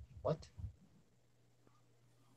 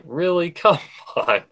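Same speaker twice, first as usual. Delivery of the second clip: incredulous; 6 semitones higher, 3 dB louder than usual. Retold or imitated. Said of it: "I'm like".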